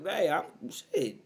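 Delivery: normal talking voice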